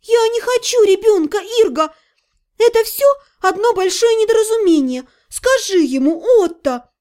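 high-pitched, flustered denial; protesting heroine. Acted. Heroine denying she wants a child, high flustered protest. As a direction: plead that it is a misunderstanding.

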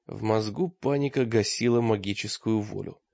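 plain reading voice

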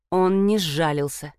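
usual speaking voice

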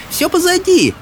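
high reassuring shout. A narrator's reading. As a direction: call out that it's over. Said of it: "кричала им Софи".

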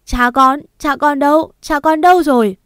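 high, loud howl for her father; anguished wail. A narrator's reading. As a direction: cry out desperately to the fire. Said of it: "tôi gào lên".

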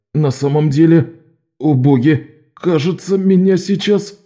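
queasy, about to be sick; nauseous. Acted. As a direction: voice rising into sickness, slowing. Локу становится дурно. (голос человека, которого вот-вот стошнит)

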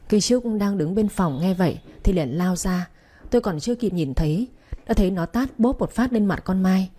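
ordinary delivery, nothing marked

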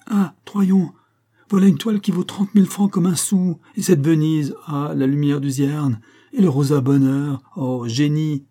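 high, loud rapturous exclamations; gushing admiration. A narrator's reading. Academician marvelling at his paintings, gushing admiration. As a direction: go into raptures over every canvas. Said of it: "en se répandant en phrases admiratives".

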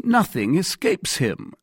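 high-pitched admiring exclamation; exclaimed. A narrator's reading. Athos marvels at the Gascon, exclaiming. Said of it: "cried Athos".

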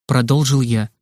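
plain reading voice

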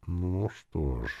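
very slow, drawn-out musing; hesitant. A narrator's reading. Druid mulling the orc question, hesitant. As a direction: stretch it out, undecided.

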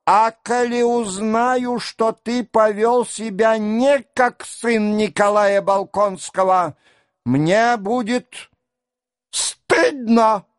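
put-on high, strident old prince; old father's high-pitched outburst; shrieky old man. A narrator's reading. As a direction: pitch it up into a scolding cry, rising to a squeal. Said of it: "крикливым голосом продолжал".

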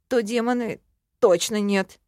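plain reading voice

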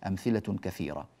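ordinary talk, nothing marked

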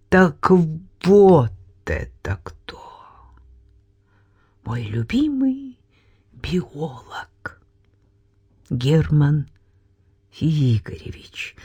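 stunned half-whisper. Acted. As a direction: breathe it out without strength. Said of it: "прошептала я".

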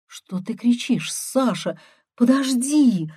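high, thin protest; faint sister's voice. A narrator's reading. Sister trying to stop brother's shouting, faint and high. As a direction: plead lightly from down the line. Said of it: "слабо доносился до меня Иркин голос".

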